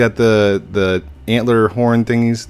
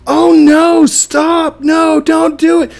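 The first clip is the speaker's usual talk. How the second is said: high, loud, drawn-out wail of fake panic; mock panic; 10 dB louder, slower than usual. Streamer fakes panic at a hacker deleting his base, hammed-up wailing. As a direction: scream and plead, hamming it up. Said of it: "and I went".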